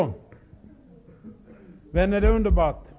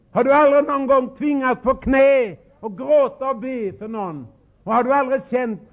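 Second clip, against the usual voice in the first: raised, pleading preacher voice; impassioned plea; 6 semitones higher, 5 dB louder than usual. Real, not acted. Preacher pleading with the congregation, loud and impassioned. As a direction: cry it out to the congregation.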